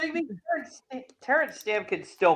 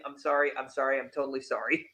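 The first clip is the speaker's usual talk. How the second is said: sheepish apology; 4 semitones lower, 9 dB quieter, faster than usual. Retold or imitated. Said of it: "sneaking right in, saying".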